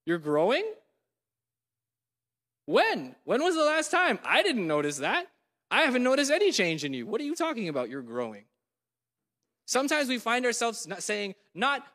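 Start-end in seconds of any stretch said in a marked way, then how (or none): 0.0-8.4 mock disbelief. Jesus mocking the humble brag.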